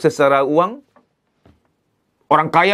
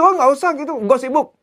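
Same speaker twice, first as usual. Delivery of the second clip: dismissive husband; 8 semitones higher than usual. Retold or imitated. Husband brushing off wife's parenting advice, high and dismissive. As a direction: wave it off, too busy to care. Husband refuses traditional play.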